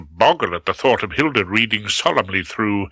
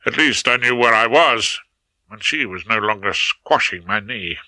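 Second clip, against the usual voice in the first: ordinary delivery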